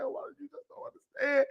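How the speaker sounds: mock-tearful hushed plea; tearful whisper. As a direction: whisper it like holding back tears. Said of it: "I'm like".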